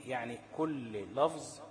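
ordinary talk, nothing marked

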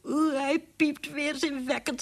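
high, slow, drawn-out revulsion; disgusted mother. A narrator's reading. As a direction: recoil from the squeaking mouse as the mother. Mother disgusted by squeaking mouse.

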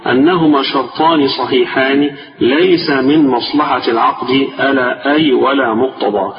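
normal talking voice

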